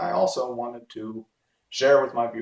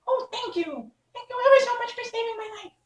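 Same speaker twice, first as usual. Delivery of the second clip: tiny mouse squeak, much higher and fast; squeaky little voice; faster than usual. Acted. Field mouse squeaks thanks to the Woodman, high and tiny. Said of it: "in a squeaky little voice".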